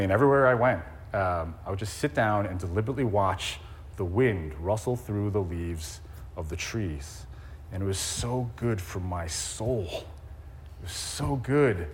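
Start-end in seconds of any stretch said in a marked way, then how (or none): 5.0-11.9 slow and soft. Real, not acted. Savouring the memory, slowing down.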